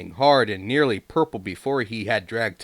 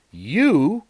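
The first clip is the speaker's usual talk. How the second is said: scornful snort; 9 semitones higher, 7 dB louder than usual. A narrator's reading. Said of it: "snorted Henry".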